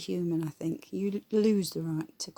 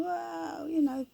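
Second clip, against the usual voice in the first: mock awe; 8 semitones higher, much slower than usual. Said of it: "everybody's going".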